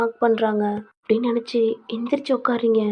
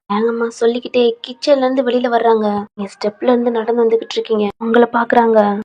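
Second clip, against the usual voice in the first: usual speaking voice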